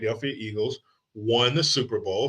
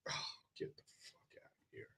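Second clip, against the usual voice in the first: fast whisper of disbelief; 27 dB quieter, faster than usual. Real, not acted. Reacting to the friend's refusal, fast whisper. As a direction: whisper it like you can't believe him.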